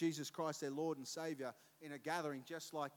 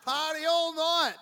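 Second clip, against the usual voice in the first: emphatic punchline; 13 semitones higher, 15 dB louder than usual. Real, not acted. Repeats brother's party punchline.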